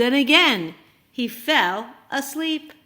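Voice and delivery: plain reading voice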